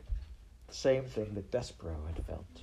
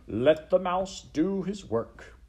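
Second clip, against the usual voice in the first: high, loud, slow relish; Botticelli; 9 semitones higher, 5 dB louder, slower than usual. Acted. Rat tells the crowd to let the mouse work, gloating. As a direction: draw it out like a gloating ringmaster. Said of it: "still laughing".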